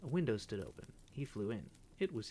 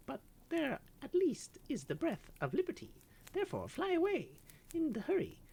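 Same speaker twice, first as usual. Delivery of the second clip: high, urgent little bird voice; tender canary; 5 dB louder than usual. Acted. Canary urging the grey bird to fly out the open window. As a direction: urge the friend to flee.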